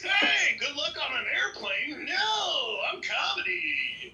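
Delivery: high, shouted mock of the rival; mocking impression. Mocking imitation of rival again.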